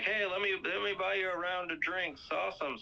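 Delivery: high, upbeat imitation; friendly offer. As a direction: play a chummy guy treating everyone. Acted friendly offer of drinks.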